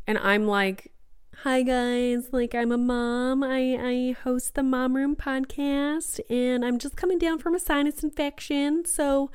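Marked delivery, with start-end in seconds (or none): 1.4-9.3 higher-pitched Southern mom voice; Southern accent. Acted. Mom introducing herself to younger partiers, Southern accent. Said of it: "turning into, like, a Southern person".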